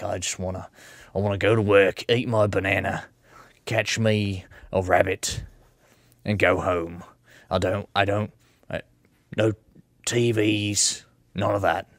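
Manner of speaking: mock Australian guy. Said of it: "this character I'm doing right now".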